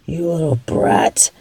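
a low, unvoiced whispered insult; whispered curse. Villain curses victim under breath.

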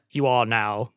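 lightly acted pack-leader vow; quoted solemn declaration. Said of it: "I believe the exact phrasing was".

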